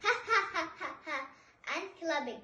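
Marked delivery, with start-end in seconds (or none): 0.0-1.2 higher, staged ha-ha laugh; demonstrated laugh. Shown as a demonstration. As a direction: act out laughing in a quick string.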